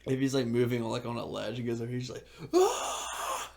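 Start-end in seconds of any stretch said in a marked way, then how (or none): 2.5-3.5 very high, loud "ah" scream; imitated climber's scream. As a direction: imitate a climber yelling between holds. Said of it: "He's just screaming".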